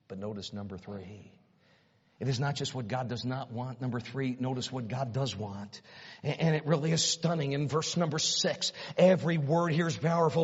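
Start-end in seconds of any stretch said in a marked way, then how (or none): none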